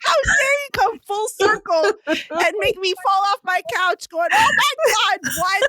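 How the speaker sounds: high squealed tirade building to a shriek; hammed-up overwhelmed outburst; mock outraged fangirl. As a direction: rant high, then shriek in disbelief. Acting out falling off couch reaction.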